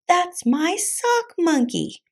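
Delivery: high, drawn-out indignant cry; high cartoon protest; yelling. A narrator's reading. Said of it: "yelled Scapito".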